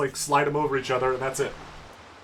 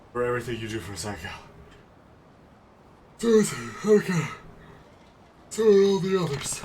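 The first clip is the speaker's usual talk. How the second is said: gentle; 4 semitones lower, 5 dB quieter than usual. Pretend. Father comforting daughter in game.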